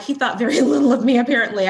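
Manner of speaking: laughing through the words at his doubt; rueful laugh while saying it; amused disbelief, laughing. Real, not acted. Mocking partner's low opinion.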